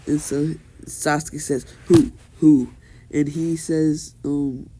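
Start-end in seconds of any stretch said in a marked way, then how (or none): 1.9-2.7 demanding. Retold or imitated. Sasuke demands who beats them.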